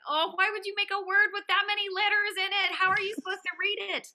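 high, whiny mock despair; stressed-out complaint, pitched right up; comic exasperation at an impossible task; exasperated. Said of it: "times where I'm like".

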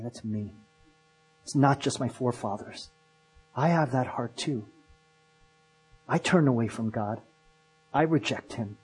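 low, hushed, introspective admission; looking inward. Retold or imitated. People hearing their own story and looking inward, quiet imitated confession. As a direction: confess it softly, as if to yourself. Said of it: "they started looking inward".

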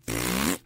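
long raspberry with the tongue out; imitated taunting raspberry noise; blown raspberry. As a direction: blow a rude child's raspberry. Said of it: "stuck his tongue out and said".

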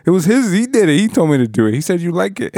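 put-on high, panicky kid blaming someone else; imitating a scared kid pointing the finger, breaking into a laugh; pleading excuse. Kid blaming the other uncle.

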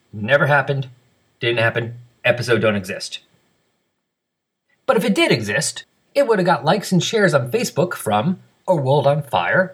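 brisk, emphatic podcast announcer delivery; scripted announcer read. Acted. Host reads feedback and listener names to the audience, announcer style. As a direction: read it out crisply with swinging, sing-song list intonation.

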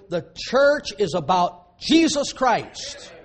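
preaching at full force. Acted. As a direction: hammer the point home to the congregation. Preaching climax.

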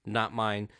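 ordinary delivery

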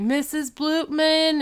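mock yell. Angry roommate summoning the house mother, mock yelling. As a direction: call out the name high and slow. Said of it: "she starts yelling".

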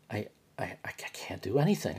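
barely voiced, drained whisper; defeated whisper. Real, not acted. Worn-out patient at home admits defeat, whispering. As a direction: whisper it like you have nothing left. Demoralized, can't function.

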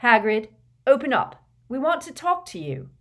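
high, loud demand; calling out. A narrator's reading. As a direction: call through a closed door. Harry calls through a shut door.

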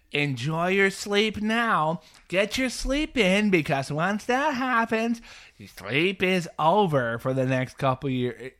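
high, drawn-out knowing warning; slow, singsong imitation of smug advice; mock warning parent.

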